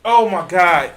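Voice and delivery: loud exasperated exclamation; exasperated outburst. Real, not acted. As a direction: exclaim loudly at what you just heard. Exasperated reaction to played clip.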